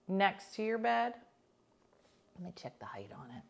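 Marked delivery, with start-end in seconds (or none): 2.4-3.4 whispered aside; muttered under the breath. Real, not acted. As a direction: whisper it to yourself. Aside while checking height.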